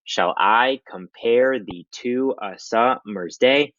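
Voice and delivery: "Shall I compare thee to a summer's day" slowly, every second syllable stressed; iambic stress pattern. Shown as a demonstration. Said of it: "say it out loud and you should be able to hear it".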